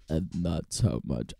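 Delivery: very low, slow, soft villain drawl; Severus Snape. Acted. Host trying out an impression, low slow drawl. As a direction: draw it out low and slowly. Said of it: "trying to do Severus Snape".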